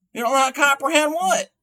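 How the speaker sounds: "what" rising high and fast; incredulous. Acted. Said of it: "You don't know how to comprehend this?".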